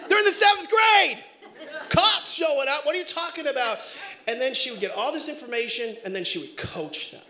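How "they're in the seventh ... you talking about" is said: higher, louder panicked yell; mock freak out. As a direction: shout it in comic alarm. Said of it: "and I'm freaking out".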